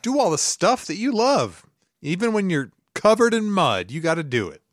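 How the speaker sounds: high-pitched, rousing pep talk; motivational slogan. Acted. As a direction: proclaim it like a motivational speaker. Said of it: "is there any better motivational phrase".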